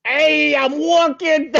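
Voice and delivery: loud, high New York walrus; brash New York accent, loud and high. Pretend. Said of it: "What would a New York walrus sound like?".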